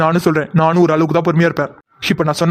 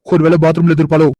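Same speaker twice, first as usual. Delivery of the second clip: normal talking voice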